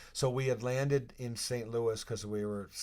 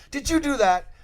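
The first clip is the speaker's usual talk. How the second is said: higher, louder mock accusation; hammed-up coach-like demand; imitating angry Gretz; 12 dB louder than usual. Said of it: "Gretz turns to me and goes".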